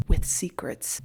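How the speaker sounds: low, secretive whisper; close-up whisper. Retold or imitated. Finnick up close to Katniss, whispering. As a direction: whisper it like a confidence. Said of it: "he gets up close and he says".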